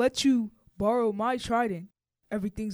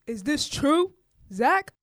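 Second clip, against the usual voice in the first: loud stern king; 5 semitones higher, 7 dB louder, slower than usual. Acted. King demands, raising voice.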